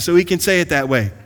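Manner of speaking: usual speaking voice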